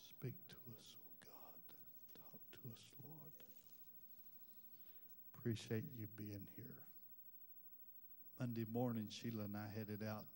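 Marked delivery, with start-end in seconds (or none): 0.0-3.3 breathless whisper of a prayer; hushed whispered plea. Real, not acted. 5.4-6.8 soft whispered welcome; whispered greeting. Real, not acted. Greets congregation after whispered prayer.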